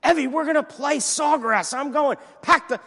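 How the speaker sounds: loud, high excited outburst; off-the-charts excitement. Retold or imitated. Golfer telling Evie they will play Sawgrass, wildly excited. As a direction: blurt it out, thrilled and loud. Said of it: "My excitement level just went off the charts".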